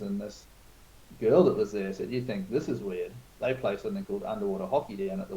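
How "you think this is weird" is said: usual speaking voice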